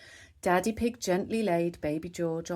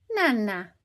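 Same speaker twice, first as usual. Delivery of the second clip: put-on high baby-talk word; a hint of a little piglet voice; toddler offering; 4 semitones higher, 6 dB louder than usual. A narrator's reading. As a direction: hold it out like a tiny child. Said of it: "she said".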